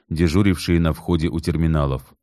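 ordinary delivery

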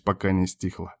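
plain reading voice

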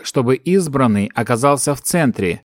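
usual speaking voice

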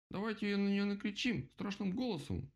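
high-pitched vole squeal. A narrator's reading. Vole offers a scarier plan, squealing. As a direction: squeal it out eagerly, falling at the end. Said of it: "взвизгнула полевка".

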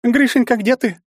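high, loud motherly call; woman calling out. A narrator's reading. Mother looking for her son, calling in high voice. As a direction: call for your child across a distance. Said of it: "раздался откуда-то издалека женский голос".

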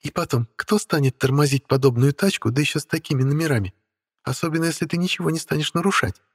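high, light voice of a spoiled beauty; haughty young woman voice. Acted. Witch Stella speaking. (капризная молодая ведьма)

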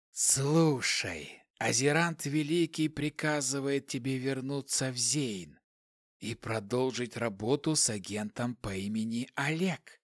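quiet, emotionless hissing voice. A narrator's reading. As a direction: hiss it softly, without feeling. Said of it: "Шипящий голос не выражал никаких эмоций".

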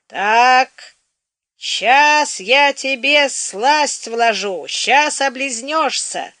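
acted sing-song wheedling, drawn out; drawn-out coaxing. A narrator's reading. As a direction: stretch each word as you coax the child. Woman coaxing child before painful remedy.

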